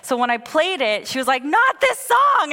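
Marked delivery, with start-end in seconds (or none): 1.4-2.5 high, loud, drawn-out mock wail; whiny toddler. Mom imitating her daughter rejecting the song, whiny tantrum. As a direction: protest like an upset small child. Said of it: "she's just crying and whining".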